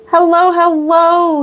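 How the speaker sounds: high, slow cooing greeting. Real, not acted. Greeting the pet on camera, high slow cooing baby talk.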